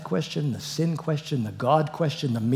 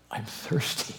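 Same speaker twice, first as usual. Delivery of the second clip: whispered; 3 semitones lower, 7 dB quieter, slower than usual. Acted. Dying Jesus voiced.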